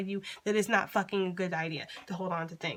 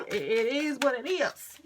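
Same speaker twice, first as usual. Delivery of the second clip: "it is what it is" slower and higher with a rising end; drawn-out mantra; 5 semitones higher, 5 dB louder than usual. Real, not acted. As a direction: stretch "it is what it is" into a sing-song mantra. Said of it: "That just needs to be the fucking mantra".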